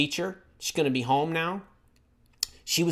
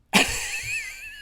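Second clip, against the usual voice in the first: high laugh; 4 dB quieter than usual. Real, not acted. Laughs at chat.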